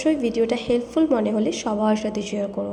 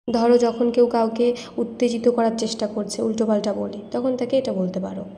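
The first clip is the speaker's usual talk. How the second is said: usual speaking voice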